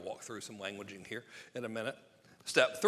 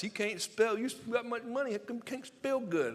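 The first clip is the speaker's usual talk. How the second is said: high-pitched mock heckler voice; mocking online commenters; 10 semitones higher, 4 dB louder than usual. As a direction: mimic the haters in a raised, silly voice. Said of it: "And then comments".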